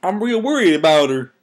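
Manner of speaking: pony character voice. Acted. Applejack worried about depressed Pinkie, lilting character voice. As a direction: keep the swooping put-on voice going. Reader voicing Applejack's dialogue.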